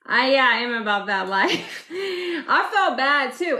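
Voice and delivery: high, giggling through the words; half-embarrassed laughter; laughing. Real, not acted. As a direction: laugh while owning up. Laughing while admitting the fight.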